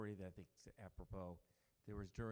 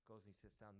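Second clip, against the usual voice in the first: usual speaking voice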